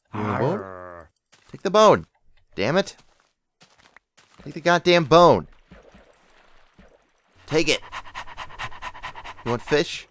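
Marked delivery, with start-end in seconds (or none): 1.5-3.0 louder, higher insistence; exasperated command. Real, not acted. Dog refuses the offered bone. 4.4-7.8 loud, raised frustrated order; fed-up command; exasperated. Real, not acted. Dog still refuses the bone.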